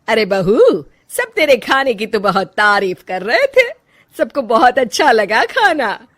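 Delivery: put-on loud, commanding older woman calling her daughter-in-law; bossy mother-in-law. Mother-in-law orders her bahu, loud and bossy. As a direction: boss the bahu around from the doorway.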